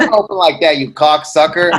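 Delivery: loud, mock-outraged telling off; a friend shouting in fake outrage; mock angry scolding. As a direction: bark it like a fake-offended friend.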